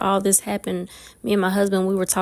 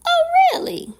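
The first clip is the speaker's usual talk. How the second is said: high falsetto imitation of the neighbor; exaggerated, loud high-pitched surprise; pointed "oh, really?"; 5 dB louder, faster than usual. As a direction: squeal it up high, ready for action. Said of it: "when he said".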